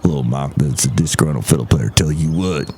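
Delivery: very low put-on character voice; visiting comic character. Visitor greeting the host, very low character voice. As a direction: drop right down for the odd visitor. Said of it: "Look who else is here".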